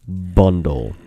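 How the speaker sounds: loud, deep Bundle; deep mic voice. Shown as a demonstration. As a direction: boom Bundle out like a cozy narrator. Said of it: "slowly and deeply into a microphone".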